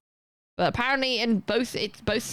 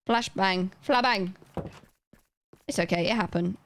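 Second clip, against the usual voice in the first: ordinary talk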